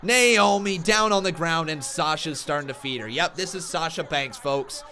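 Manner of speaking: high, loud hyped wrestling commentary; live play-by-play. Acted. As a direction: call the brawl like a ringside announcer.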